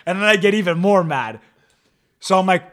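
ordinary talk

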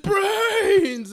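high, loud laughing zombie cry; mock zombie. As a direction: howl it like a movie zombie. Zombie lunging at Bert.

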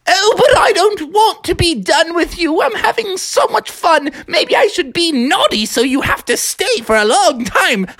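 high, gleeful pleading; excited Froggy. Acted. Froggy begging the nanny to stay, high and gleeful. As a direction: squeal with mischievous delight.

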